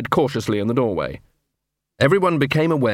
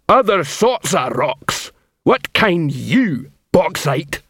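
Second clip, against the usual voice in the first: slow, higher-pitched hectoring troll; troll drill sergeant; 4 semitones higher, slower than usual. Acted. Troll sergeant demanding an answer from Bauxite, hectoring. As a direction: challenge the recruit, word by word.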